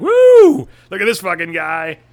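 high loud whooping cheer; imitating bachelorettes cheering; mock cheering women. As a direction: whoop it up like a rowdy crowd. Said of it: "all the broads cheered, like".

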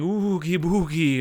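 slow, drawn-out ghostly words; mock ghost call at normal volume; spooky ghost imitation. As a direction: stretch it like a haunting ghost. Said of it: "Looking in the mirror and saying".

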